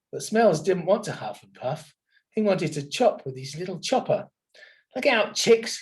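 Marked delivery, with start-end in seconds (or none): 5.0-5.8 higher, lightly raised call; shouting. Acted. Little Wolf warns the chicks before huffing, raised call. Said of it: "he shouted".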